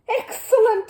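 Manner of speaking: loud excited cry. A narrator's reading. Neighbour slapping his knee, crying out in loud delight. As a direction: burst out with delight. Mr Curry applauding loudly.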